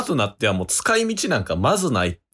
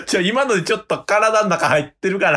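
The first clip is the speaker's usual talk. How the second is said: laughing; 9 semitones higher than usual. Real, not acted. Complaining while laughing.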